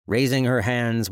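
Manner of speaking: ordinary delivery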